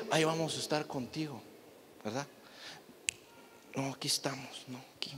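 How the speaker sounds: soft, hollow consoling murmur; fake sympathy. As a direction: play the insincere mourner, barely there. Fake comforters at a wake. (pésame falso, en voz baja y hueca)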